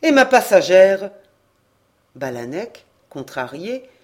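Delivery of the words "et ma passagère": loud, pointed question; a sailor remembering his passenger, called loudly; calling out. A narrator's reading. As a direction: call it out across the deck.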